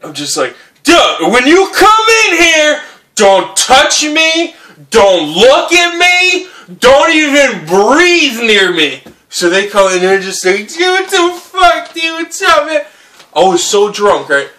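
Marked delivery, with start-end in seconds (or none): 0.8-9.0 put-on high, loud, drawn-out drunken shout; drunken yelling. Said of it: "I just start yelling". 10.7-12.8 high-pitched mock yelling; screaming buddies. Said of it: "They're screaming".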